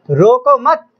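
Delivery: "रोको मत" as one phrase, pitch rising on मत; comma pause after मत. Shown as a demonstration. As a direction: say "रोको मत" together, lifting मत before the break. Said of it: "तो अब देखें क्या बात बनी". ("रोको मत" एक साथ, मत पर सुर उठाकर ठहराव)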